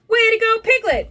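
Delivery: high, loud cheer; excited storybook congratulations; exclaiming. A narrator's reading. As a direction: cheer on a friend. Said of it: "exclaimed Tigger".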